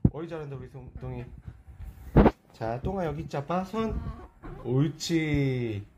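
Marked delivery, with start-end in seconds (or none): 4.6-5.9 slow, stretched-out pet praise; squeaky praising a dog. Real, not acted. Praising dog after paw. (반려동물 칭찬하는 늘어진 목소리)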